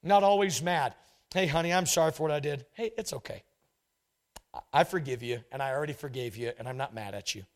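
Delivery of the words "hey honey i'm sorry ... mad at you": gentle forgiving partner. Retold or imitated. Preacher voices forgiving partner.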